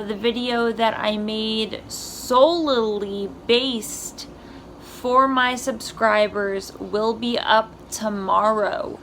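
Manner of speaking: ordinary talk, nothing marked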